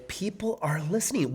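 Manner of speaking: whisper. Real, not acted. Aside whisper.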